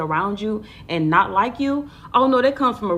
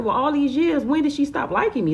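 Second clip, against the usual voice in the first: much higher, indignant disbelief; shocked question; 9 semitones higher, 3 dB louder than usual. Retold or imitated. Asking her sister why an old friend stopped liking her, shocked. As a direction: ask it high, stunned and hurt.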